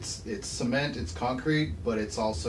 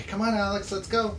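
parent calling; 10 semitones higher, 4 dB louder than usual. Acted.

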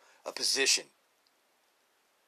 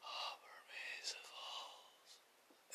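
slow breathy whisper; 23 dB quieter, much slower than usual. Shown as a demonstration. Teaching viewers his calming trick, slow whisper. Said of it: "You say it with your breath".

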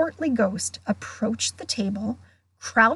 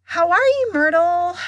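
high, loud, overdone cheeriness; falsely bright; 8 semitones higher, 6 dB louder than usual. A narrator's reading. Girl greeting the glum ghost, falsely bright. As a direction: greet her with forced delight. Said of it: "in a falsely bright voice".

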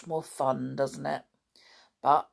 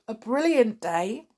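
ordinary delivery, nothing marked

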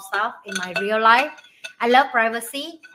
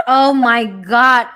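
drawn-out loud oh my God; slow, loud, embarrassed cry; loud exclamation; 12 dB louder, much slower than usual. Real, not acted. Reacting to embarrassing video.

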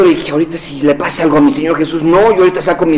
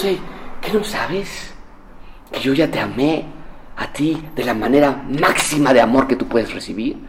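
gentle God; 5 semitones lower, 9 dB quieter than usual. Acted. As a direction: hush them with kindness. God answering the guilty believer.

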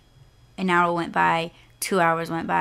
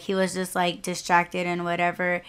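normal talking voice

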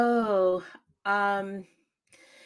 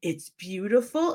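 slow, drawn-out sneering mockery; mocking; 3 semitones higher, slower than usual. Teacher mocking Carrie's answer in front of class, slow and sneering. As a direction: stretch it out like a teacher mocking a shy girl. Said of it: "this teacher starts mocking her".

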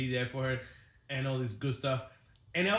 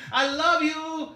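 much higher, louder gushing I love you; gushing wife; 11 semitones higher, 6 dB louder, much slower than usual. Retold or imitated. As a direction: mimic the wife's sweet gush. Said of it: "She loved her".

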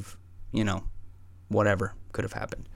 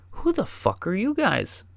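a hint of a shrill startled woman, higher; higher-pitched mock demand from the woman; woman startled by strangers in her flat; mock angry woman; 9 semitones higher than usual. Woman confronting intruders.

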